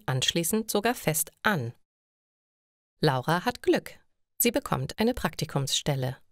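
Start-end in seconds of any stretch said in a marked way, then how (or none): none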